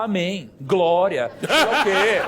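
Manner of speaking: loud, high mock crowd yell; half-laughing imitation of crowd cries; imitating crowd shouting. Speaker imitating the worshipping crowd with a loud yell. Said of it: "uma galera já começou a gritar".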